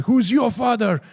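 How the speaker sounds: high mock interrogation; comical shouting. Guru turning on another priest, comical high shout. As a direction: imitate a comic guru, pitch leaping up. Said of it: "he started shouting".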